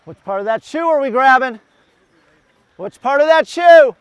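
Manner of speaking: loud, high shouted question; building to a big coaching shout; calling out. Real, not acted. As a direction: holler it to runners at a distance.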